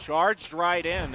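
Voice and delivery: slow, loud "charged right in" with "charged" hit hard; drawn-out emphasis. Real, not acted. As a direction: stretch out "charged right in" and lean on "charged". Caster punches the play call.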